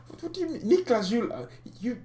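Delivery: squeaky disbelieving jump up; can't believe what he just read; incredulous. Real, not acted. Reacting to a viewer comment, disbelieving squeak.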